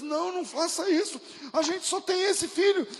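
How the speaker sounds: high, loud pleading cry; desperate plea. Retold or imitated. Mother begging the tribe to spare her son, high and loud. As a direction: beg them not to take your only son.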